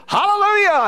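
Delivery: high, loud ringing hallelujah; soaring shouted praise; mock exuberant hallelujah. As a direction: call it out like a fervent worshipper. Imitating Indian church call.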